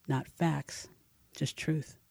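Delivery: plain reading voice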